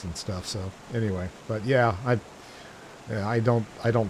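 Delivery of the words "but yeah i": ordinary talk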